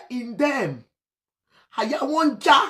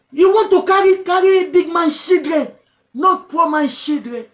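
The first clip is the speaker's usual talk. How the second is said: raised, almost shouting plea, voice pushed high; loud pleading; 5 semitones higher, 5 dB louder than usual. Real, not acted. Radio host shouting his plea to the bandits, loud and desperate. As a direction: beg them at full volume, urgent and strained.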